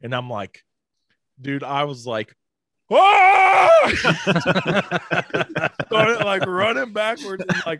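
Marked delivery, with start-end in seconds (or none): 2.9-3.8 loud, high yell of fright; reenacted terrified scream. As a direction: scream as if reliving the scare. Reenacts his scream on seeing eyes.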